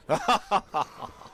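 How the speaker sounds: short real laugh. Real, not acted. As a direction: burst out laughing. Laughs at own joke.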